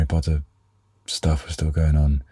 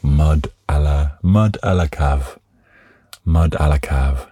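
put-on French accent. Mock fancy French dish names.

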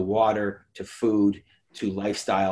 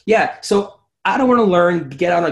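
higher and louder, emphatic; 6 semitones higher, 6 dB louder than usual. Real, not acted. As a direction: jump in with energy. Emphatic rebuttal.

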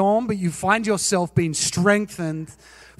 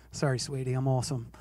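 soft, lower, sugary fake apology; mock sweet reply; 7 semitones lower, 10 dB quieter, faster than usual. To his wife, a soft mock-sweet apology. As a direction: play innocent, quiet and low.

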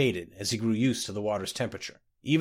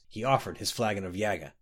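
usual speaking voice

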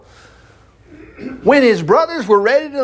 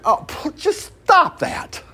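scoffing dismissal; 10 semitones higher, slower than usual. Real, not acted. Preacher scoffing at an interpretation.

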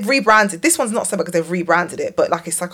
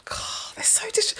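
exasperated whisper; 12 semitones higher, 11 dB quieter than usual. Real, not acted. Exasperated whisper about sundaes.

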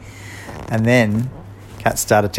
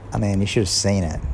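usual speaking voice

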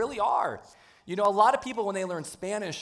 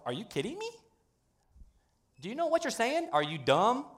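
high, rising disbelief; scoffing at the idea; mock incredulity; 6 semitones higher than usual. Christians reacting to the word, mock disbelief. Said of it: "it's like".

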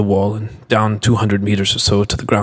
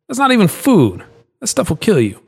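scolding; 10 semitones higher than usual. Acted. Carlos scolding Julian.

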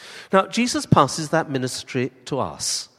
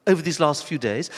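a raised, higher rebuke of a stranger; mildly voiced disciples scolding the stranger; rebuking; 4 semitones higher, 6 dB louder than usual. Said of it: "they rebuked him".

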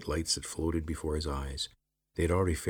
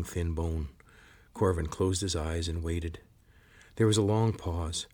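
ordinary delivery, nothing marked

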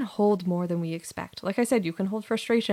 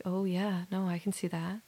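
quiet; 8 dB quieter than usual. Retold or imitated. Quiet admission to a comment.

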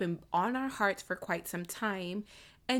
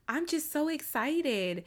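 ordinary talk

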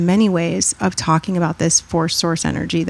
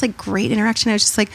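usual speaking voice

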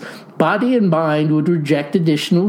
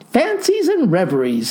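a touch of scolding, pitched higher; 5 semitones higher than usual. Acted. Wife scolding her tanned husband, mild scolding. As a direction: mildly chide him, lightly voiced wife. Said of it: "scolded Margaret".

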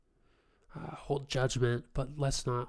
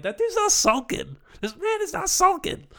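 much higher, louder mock protest; hammed-up voice of disbelief; incredulous protest; 9 dB louder than usual. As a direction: squeal your disbelief at the picture. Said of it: "I'm like".

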